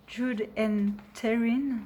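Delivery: names said slowly with an English accent. Shown as a demonstration. Naming the sisters to viewers, in an English accent. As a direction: slip into English pronunciation for "Jude and Taryn". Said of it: "je dis ça avec un accent anglais".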